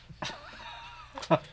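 high breathy giggle; a quick airy chuckle; breathy laugh. Real, not acted. Laughs at own failed accent.

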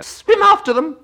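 very high, loud scream. A narrator's reading. Gryphon calls the next dance move, screaming. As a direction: shriek the order at the top of your range. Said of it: "screamed the Gryphon".

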